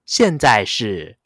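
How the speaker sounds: slow, deliberate phone clock announcer; time announcement voice. Retold or imitated. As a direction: read the time like the recorded hotline. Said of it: "你不是打那个电话".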